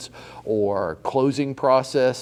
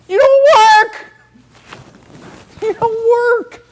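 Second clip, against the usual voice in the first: shouting; 12 dB louder, slower than usual. Real, not acted. Coach shouting at a whining agent, full yell. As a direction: yell it high and very loud, then slower.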